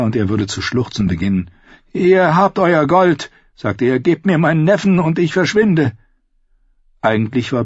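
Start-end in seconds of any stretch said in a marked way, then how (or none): 1.9-5.9 trying to sound firm but afraid; a hint of a scared young lord; frightened lord Merritt. Merritt voiced in story.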